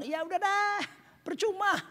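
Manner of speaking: high-pitched, waving-it-off mock quote; acted dismissal. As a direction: dismiss it as not worth bothering with. (menirukan orang meremehkan, nada tinggi)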